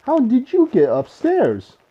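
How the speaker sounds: the watchman puzzled to find the bear; surprised watchman. A narrator's reading.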